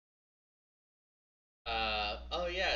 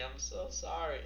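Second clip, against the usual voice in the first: ordinary talk, nothing marked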